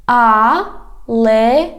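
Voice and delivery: "Алея" slow, in separate syllables. Shown as a demonstration. Teacher has learners repeat, "Алея" slowly by syllables. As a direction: draw out each syllable of "Алея". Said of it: "divide it into syllables".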